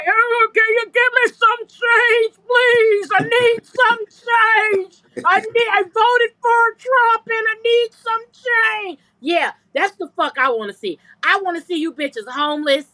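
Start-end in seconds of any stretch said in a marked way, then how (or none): 0.0-9.0 put-on high, slow, trembling old beggar voice; pleading beggar. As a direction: beg for change in a thin, high, drawn-out voice. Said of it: "a farmer talking like R F K".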